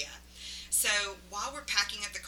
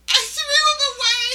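loud, high, laughing; laughing confession; 17 dB louder than usual. Retold or imitated. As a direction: blurt it out through laughter.

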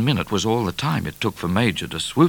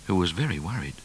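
plain reading voice

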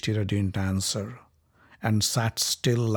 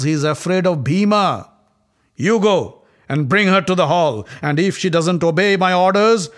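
louder, higher scornful order; scornful command; 5 semitones higher, 5 dB louder than usual. Acted. Prince ordering his brother to fetch her, louder and scornful. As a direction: sneer at the fool, then command.

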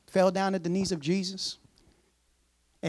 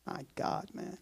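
breathless aside; 6 semitones lower, 16 dB quieter, slower than usual. Real, not acted. Preacher aside, dismayed.

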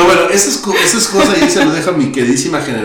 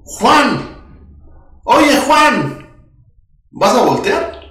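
high, far-carrying street call; mock shout to a passerby; 7 semitones higher, slower than usual. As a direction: yell a name across the street. Calling out to Frank on street.

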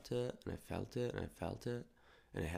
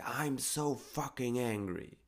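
slow, higher, drawn-out mock outburst; venting anger; 5 semitones higher, slower than usual. Shown as a demonstration. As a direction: let raw anger out, not shouted. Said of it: "you could say".

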